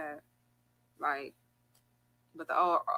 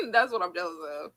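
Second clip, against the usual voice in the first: laughing; 7 dB louder than usual. Real, not acted. Laughing while repeating.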